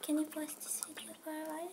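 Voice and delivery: whispering. Real, not acted. Whispered aside to pause video.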